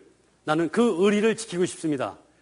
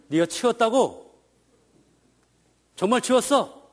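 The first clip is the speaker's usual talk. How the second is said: suspicious parent; 5 semitones higher than usual. Retold or imitated. Parent doubts child's answer.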